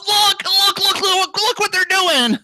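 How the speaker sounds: very high mimicked gawking voice; mocking, high-pitched yokel excitement; excited idiot gawker. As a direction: squeal it like a thrilled onlooker.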